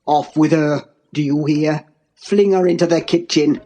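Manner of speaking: low, dark tirade; deep, menacing commands; enraged father-in-law. A narrator's reading. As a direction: rage in a deep voice without shouting. Heathcliff rages at her.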